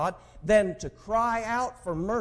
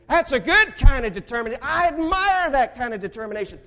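higher and louder closing appeal; preaching; 11 semitones higher, 11 dB louder than usual. Acted. Preacher closing his sermon on determination, fiery and loud. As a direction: plead from the pulpit.

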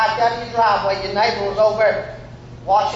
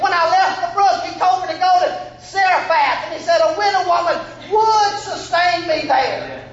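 raised, high preacher's voice; fervent preaching; 6 semitones higher than usual. Real, not acted. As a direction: proclaim it boldly to Ahab. Preacher at full force.

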